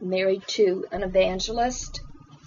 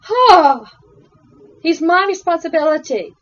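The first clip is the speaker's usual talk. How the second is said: much higher and louder, a burst of dismay; retold panicked cry of realisation; overwhelmed cry; 8 semitones higher, 8 dB louder than usual. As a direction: relive the moment it hit her. Retelling her own overwhelmed realisation.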